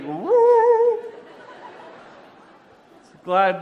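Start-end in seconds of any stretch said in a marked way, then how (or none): 0.0-1.0 a long, high "woo!" stretched out slowly; a slow rising whoo at the gleam off his head; mock wonder. Host mimics the light bouncing off the mayor's head, long high woo. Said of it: "and it's like".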